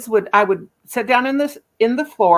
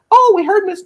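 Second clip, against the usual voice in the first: high gushing parent; 12 semitones higher, 5 dB louder than usual. Retold or imitated. Parents quoted by boss.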